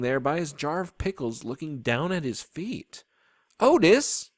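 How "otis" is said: high, loud call of the name; girl hollering to a man across the garden; hollered. A narrator's reading. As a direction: holler over the rain. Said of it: "I hollered at him over the rain".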